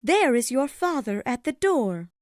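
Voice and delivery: high-pitched mother announcing an arrival; high, abrupt mother character; sudden announcement. A narrator's reading. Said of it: "said Missus Cratchit suddenly".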